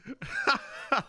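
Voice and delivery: a sudden high-pitched laugh at the insult; high burst of laughter. Real, not acted. Streamer laughs at chat calling him a bogan, a high laugh. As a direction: laugh out high, caught off guard.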